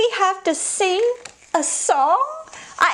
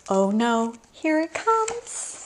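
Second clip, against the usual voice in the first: mock dread; 6 semitones higher, slower than usual. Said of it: "makes silly sounds like".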